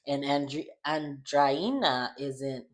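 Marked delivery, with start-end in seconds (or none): 0.9-2.1 Andreina slowly, with a Spanish-style an-DRAI-na; accented name. Shown as a demonstration. Host explaining she can only say the name this way, careful Andreina. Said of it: "not trying to accentuate that".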